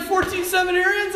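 mock excited question. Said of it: "he's like".

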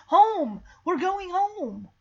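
high, excited cry, first word punched loud; overjoyed. Retold or imitated. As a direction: act out a dog thrilled at the news. Dog latches onto word home.

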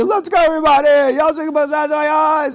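rally everyone to head out at once, overjoyed; excited Yaakov. Retold or imitated. Yaakov calling his family to go to Egypt, jubilant.

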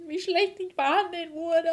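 high, drawn-out mock lament; theatralisch klagend. Overdramatic opening complaint about mistreatment. (theatralisch gedehntes Jammern)

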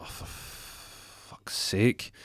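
slow, breath-heavy exasperation; soft, whispered frustration; exasperated mutter. Acted. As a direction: sigh it out under your breath, drawn out. Caller fed up with phone.

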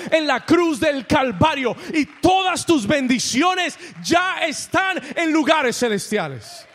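rising to a shouted climax, loud and insistent; preaching at full force. Acted. Pastor shouting the victory to the congregation, full-force preaching.

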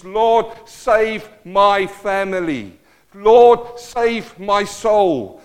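slow pleading prayer with a loud cry on Lord; a man begging God to save his family. Retold or imitated. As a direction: drag each word out like a desperate plea to heaven. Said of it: "He cries it out".

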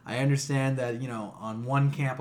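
ordinary delivery

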